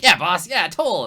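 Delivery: loud, high eager chorus of underlings; goblin henchmen. Acted. Goblin gang backs their boss's toll, loud and eager. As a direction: egg the boss on. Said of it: "they're all like".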